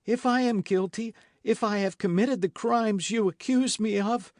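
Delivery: high, loud desperate shriek. A narrator's reading. Milady shrieking at her accusers. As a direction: shriek it at them. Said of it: "shrieked Milady".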